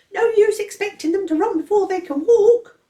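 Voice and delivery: put-on baby George to his sister. A narrator's reading. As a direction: sigh it wisely in a squeak. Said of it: "he said to Laura".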